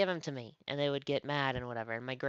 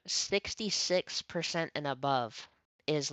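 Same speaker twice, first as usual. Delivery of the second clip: ordinary talk, nothing marked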